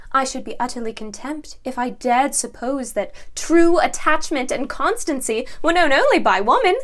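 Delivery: loud, emphatic period-drama declaration; impassioned protest. Acted. Woman answering a man on constancy in love, loudly impassioned. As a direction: protest forcefully with swelling emphasis.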